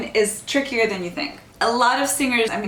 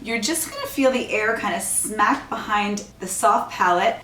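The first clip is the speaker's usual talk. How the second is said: ordinary delivery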